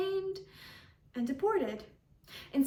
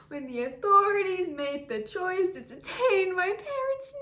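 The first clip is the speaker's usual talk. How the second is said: louder, higher, tearful indignation; anguished protest; 4 semitones higher, 6 dB louder than usual. Acted. Girl left behind tells how nobody checked on her, anguished. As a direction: build into a pained, accusing cry. Impassioned account of being abandoned.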